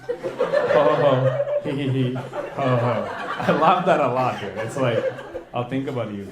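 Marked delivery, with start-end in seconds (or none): 0.1-3.1 loud fake laugh in separate batches; imitated batched laugh. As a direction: mimic someone's laugh in short bursts. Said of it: "you let it out in, like, batches".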